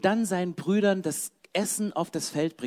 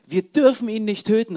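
loud, raised-pitch plea; calling out; 4 semitones higher, 8 dB louder than usual. A narrator's reading. Ruben pleads with his brothers, calling out. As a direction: call it out to stop them. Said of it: "rief er".

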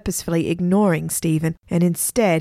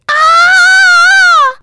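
shrill startled scream, very high and loud; sudden scream; 13 dB louder than usual. A narrator's reading. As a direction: scream in shock as if grabbed from behind. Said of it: "he screamed".

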